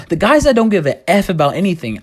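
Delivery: usual speaking voice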